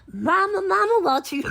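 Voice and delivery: high, loud calling for mama; imitating a call. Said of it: "she'll be like".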